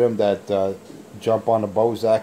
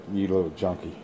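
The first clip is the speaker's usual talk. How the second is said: ordinary talk, nothing marked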